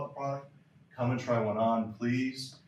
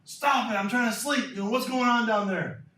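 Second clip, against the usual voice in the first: much higher, loud complaint from a woken father; annoyed neighbour's dad; 11 semitones higher, 4 dB louder than usual. Retold or imitated. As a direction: call down at them like a sleepless dad. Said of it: "his dad is like".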